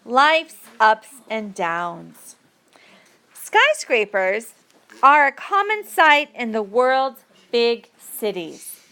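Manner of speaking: ordinary talk, nothing marked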